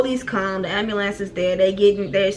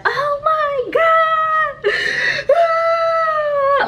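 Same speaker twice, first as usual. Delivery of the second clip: long, piercing panicked shriek; full-blown panic; 4 dB louder, much slower than usual. Retold or imitated. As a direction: act out screaming in total panic, words stretched out. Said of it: "I went into a full-blown panic".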